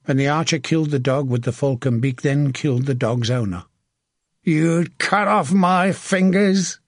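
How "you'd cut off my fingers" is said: high, drawn-out enraged cry; screamed accusation. A narrator's reading. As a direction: rage slowly at a fallen enemy. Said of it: "he screamed at the man".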